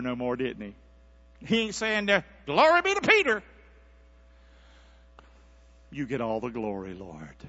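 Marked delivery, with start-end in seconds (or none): 2.4-3.4 high, mocking cry of self-praise; boastful Peter. 5.9-7.4 low, hushed surrender; humble prayer. Acted. Humble prayer after mock boast.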